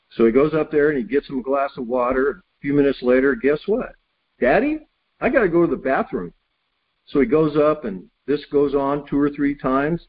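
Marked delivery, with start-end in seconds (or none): 4.4-6.3 put-on high squeaky kid's call, then quicker; a child whining for Daddy from bed; little boy voice. The boy calls his father back upstairs again, high childlike whine.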